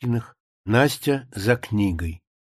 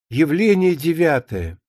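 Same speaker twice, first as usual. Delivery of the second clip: usual speaking voice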